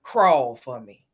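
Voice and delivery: low, slow, commanding quote; film villain's command. Retold or imitated. Quoting a Sparkle line for the host, low commanding. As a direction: order it slowly in a deep voice.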